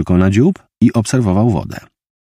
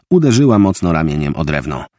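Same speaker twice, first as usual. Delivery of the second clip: usual speaking voice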